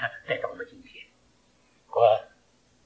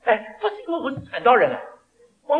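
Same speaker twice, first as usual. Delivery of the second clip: usual speaking voice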